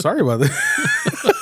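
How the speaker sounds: audio glitch warping the voice high; broken audio, pitch leaping up; glitchy jumping pitch. Real, not acted. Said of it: "My audio went all crazy".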